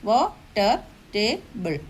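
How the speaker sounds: വാട്ടർ and ടേബിൾ each broken in two; two separate beats in വാട്ടർ and in ടേബിൾ; split into two syllables. Shown as a demonstration. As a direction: say വാ, ട്ടർ, then ടേ, ബിൾ. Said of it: "അപ്പം രണ്ട്, ഇത് ടു syllable".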